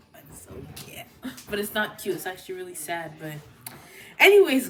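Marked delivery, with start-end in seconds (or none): 0.1-1.1 reader's whispered, voiceless reaction; hushed gushing. Retold or imitated. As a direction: whisper it very softly, almost no voice. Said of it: "I was like".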